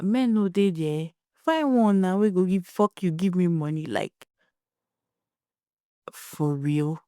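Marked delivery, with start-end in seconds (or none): 0.0-3.9 higher, pushy mock mother; mother's blunt pidgin. Mother tells crying daughter to find a man, pitched-up mock pidgin. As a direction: mimic the mother's careless brush-off, pitched up. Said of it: "And mom was like".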